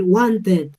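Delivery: WANTED said as "want-ed", full E vowel; wrong Spanish-style ending. Shown as a demonstration. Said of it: "pero yo no voy a decir".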